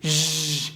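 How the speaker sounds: ژ buzzed with the voice on; voiced zh demo. Shown as a demonstration. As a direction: let ژ buzz in the throat. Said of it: "و این". (ژ واکدار)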